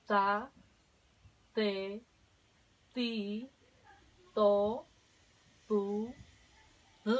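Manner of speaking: "za, te, ti, to, fu, z" con la lengua entre los dientes; sílabas con d interdental. Shown as a demonstration. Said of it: "vamos a practicar también". (práctica de sílabas entre dientes)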